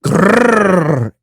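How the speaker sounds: throaty growled grrr; monster growl. Shown as a demonstration. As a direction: growl into the mic like a beast. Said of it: "And I go".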